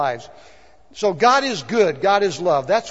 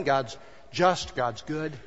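soft recap; 5 semitones lower, 8 dB quieter, slower than usual. Real, not acted. Quiet recap after loud list.

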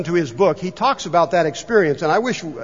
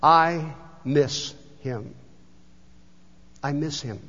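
very slow, fading to a near-hush; retold breaking voice, slow and dropping away; voice cracking; 3 semitones lower, 5 dB quieter, slower than usual. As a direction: let the words barely come out, moved. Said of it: "his voice beginning to crack".

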